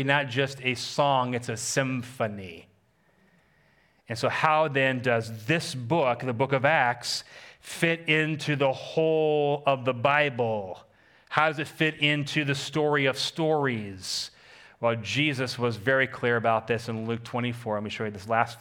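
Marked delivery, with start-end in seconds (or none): 4.1-14.3 slow, drawn-out pulpit question; emphatic preaching. Acted. Preacher asks how Acts fits the Bible, slow emphatic preaching. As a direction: stretch each phrase out for weight.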